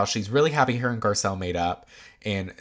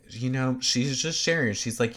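ordinary talk, nothing marked

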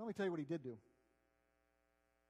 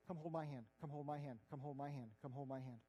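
softer and softer with each repeat, coaxing; gentle pleading call; 4 semitones lower, 7 dB quieter than usual. Retold or imitated. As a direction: fade each call gently, lower and quieter. Jesus gently calling, repeated.

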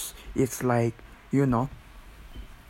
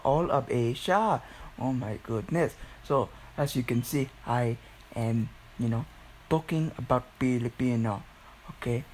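ordinary talk

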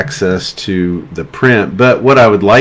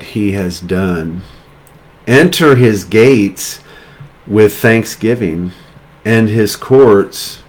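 slow, measured scripture reading with spaced phrases. Real, not acted. Preacher reading thanksgiving scripture to the congregation, slowly. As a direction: let each phrase sink in, pausing between. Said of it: "I'll read it slow so you have time".

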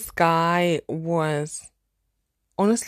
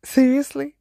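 Seriously? pitched up in disbelief; a second, more stunned Seriously?; higher louder seriously; 6 semitones higher, 3 dB louder than usual. Retold or imitated. To her friend, a stunned Seriously? pitched up. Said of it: "I was like".

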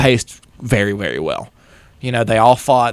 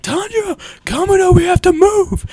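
much higher, urgent warrior voice; urgent Tengen. Retold or imitated. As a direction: hurry him along in a put-on high voice. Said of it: "He's like".

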